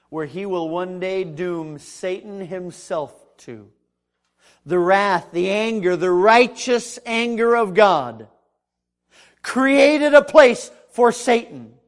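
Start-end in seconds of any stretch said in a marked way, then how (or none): 4.7-11.7 loud, slow declaration, rising in pitch; full-force preaching. Acted. Preacher on God's righteous anger, full-force preaching. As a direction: thunder it from the pulpit.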